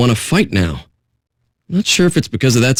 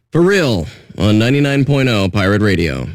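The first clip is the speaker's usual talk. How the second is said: usual speaking voice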